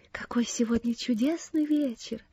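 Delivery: soft whisper from inside someone's head; hushed, breath-only exclamation; whispered wonder. A narrator's reading. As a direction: whisper it in quiet delight. Cecy speaks inside Ann's head.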